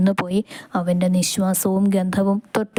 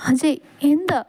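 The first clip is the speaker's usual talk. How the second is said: tearful; 7 semitones higher than usual. A narrator's reading. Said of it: "കരഞ്ഞുകൊണ്ട് പറയുന്നവളെ".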